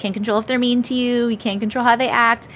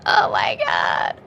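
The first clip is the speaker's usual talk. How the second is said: wheezing laugh; much slower than usual. Real, not acted. Cracking up at chat joke.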